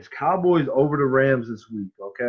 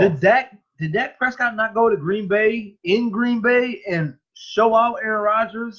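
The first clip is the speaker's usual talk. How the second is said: heated disbelief; 10 semitones higher than usual. Real, not acted. Heated argument over quarterbacks.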